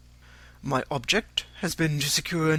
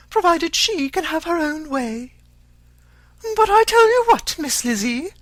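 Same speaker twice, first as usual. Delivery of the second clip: much higher and louder, a nagging self-pitying mother; scolding fretful mother; 12 semitones higher, 6 dB louder than usual. A narrator's reading. Mother scolding daughter for refusing marriage, much higher louder nagging. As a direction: scold her daughter high and aggrieved, full of nerves.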